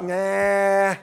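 put-on a quick, dismissive Jersey accent "Nah.". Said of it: "With a Jersey accent".

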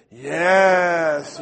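loud, drawn-out mock delight; overjoyed acceptance. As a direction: stretch out a triumphant reply. Missionaries accept dinner eagerly.

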